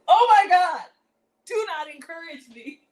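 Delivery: high, loud burst of surprise; loud exclamation. Real, not acted. Shocked shout at chat comments that egg her on. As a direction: blurt it out, caught off guard.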